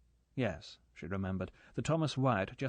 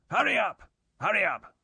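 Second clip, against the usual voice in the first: much higher and louder barked command; impatient shout; 10 semitones higher, 8 dB louder than usual. A narrator's reading. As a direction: yell it after her, furious at the delay. Impatient driver shouting after her.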